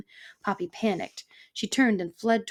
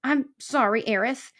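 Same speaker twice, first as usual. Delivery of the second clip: high, louder cringing apology; sniveling; 5 semitones higher, 4 dB louder than usual. A narrator's reading. Fox apologizing to the porcupine, high snivel. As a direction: grovel in a wheedling fox voice. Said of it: "a voice that was at best sniveling".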